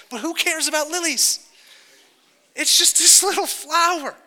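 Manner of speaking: high-pitched, incredulous brush-off; mock dismissive. Preacher teases botanists about lilies, high mock dismissal.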